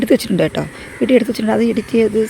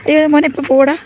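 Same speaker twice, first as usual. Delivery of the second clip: alarmed call; 7 semitones higher, 9 dB louder than usual. Real, not acted. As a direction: call out urgently to your son. Alarmed call to child about dripping sap. (കുട്ടിയോട് വെപ്രാളത്തിൽ ഉച്ചത്തിൽ)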